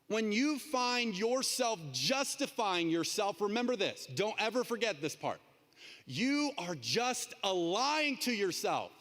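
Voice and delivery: slow, emphatic sermon delivery; earnest preaching. Acted. Preacher urging the congregation, slow and earnest. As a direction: drive the point home slowly.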